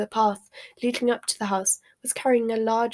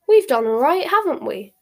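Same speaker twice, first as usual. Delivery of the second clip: delighted; 5 semitones higher, 6 dB louder than usual. A narrator's reading. Ron delighted.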